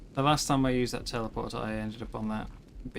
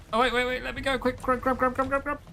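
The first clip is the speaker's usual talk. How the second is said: high, rushed panicked pleading; frantic panic; 10 semitones higher, faster than usual. Real, not acted. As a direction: scramble and plead in a hurry. Scrambling to escape creature.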